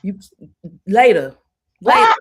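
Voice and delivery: loud, firm brush-off; brushing-off husband. Retold or imitated. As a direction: wave her off, not now. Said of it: "he's like".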